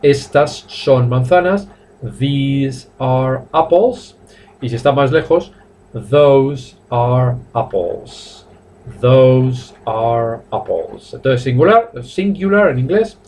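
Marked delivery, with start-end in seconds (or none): 2.2-4.2 very slow, word-by-word dictation; drawn-out teaching pace, evenly spaced; slow model sentence. Shown as a demonstration. Teacher repeats model sentence slowly. 5.9-11.1 very slow, word-by-word dictation; drawn-out teaching pace, evenly spaced; slow model sentence. Shown as a demonstration.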